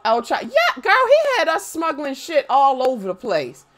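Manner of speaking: normal talking voice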